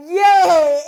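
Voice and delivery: mock cheer. Sarcastic cheer at police lights.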